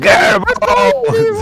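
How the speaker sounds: very high, loud drawn-out yell; shrieked call from the crowd; shouting. Acted. Some villager calling after the wagon, high shout. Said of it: "Some guy there shouting".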